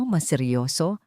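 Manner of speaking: ordinary delivery, nothing marked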